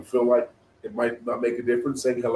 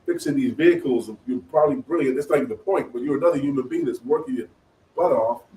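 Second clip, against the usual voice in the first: higher, emphatic disbelieving inner voice; mock-astonished retort, pitched up; incredulous; 5 semitones higher, 3 dB louder than usual. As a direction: protest in amazed disbelief. Mechanic's incredulous inner reaction retold.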